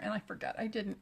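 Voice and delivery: ordinary delivery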